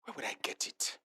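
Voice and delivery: whispered worried question. Real, not acted. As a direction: breathe it as if to yourself. Anxious private question.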